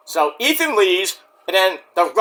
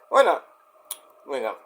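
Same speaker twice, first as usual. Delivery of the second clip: ordinary delivery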